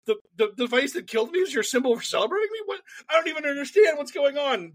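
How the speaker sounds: high, incredulous mock Jesus; baffled disbelief. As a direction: blurt it out in comic bewilderment.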